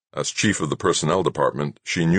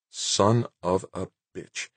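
slow, every word of "Son of a bitch" spaced apart and softer; each word distinct; 5 semitones higher, 4 dB quieter, slower than usual. A narrator's reading. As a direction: spell the curse out word by word, with feeling. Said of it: "she pronounced each word distinctly, with feeling".

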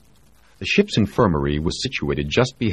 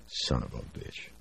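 much softer, breathless under-the-breath curse; muttered curse; 5 semitones lower, 14 dB quieter than usual. Acted. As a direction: swear under your breath. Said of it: "Jamie heard himself mutter".

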